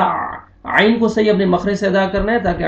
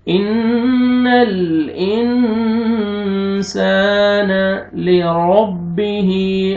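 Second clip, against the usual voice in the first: very slow, drawn-out recitation of इन्नल इंसान; slow Quran recitation; much slower than usual. Shown as a demonstration. Teacher reciting the verse for students to copy. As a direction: recite it slowly, stretching each sound. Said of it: "रा को मद जबर है".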